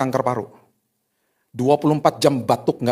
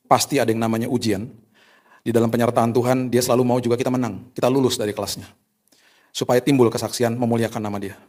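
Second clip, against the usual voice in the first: ordinary talk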